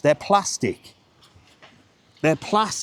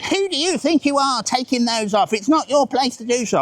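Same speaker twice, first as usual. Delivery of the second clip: higher, indignant mock complaint; mock moaning geezer; 7 semitones higher than usual.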